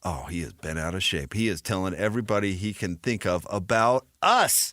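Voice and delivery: lightly voiced gloating programmer; a hint of a smug TV executive; gleeful programmer. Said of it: "Mickey Mouse, is going".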